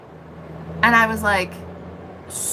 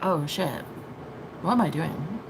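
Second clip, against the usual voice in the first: quiet realization; 6 semitones lower, 9 dB quieter than usual. Retold or imitated. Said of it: "only then was I like".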